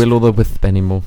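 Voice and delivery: Will-o'-the-wisp dengan wisp diucapkan berdesis, s jadi th; lisp. Real, not acted. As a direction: say Will-o'-the-wisp lisping wisp as withp. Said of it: "Why are you saying it with a lisp?".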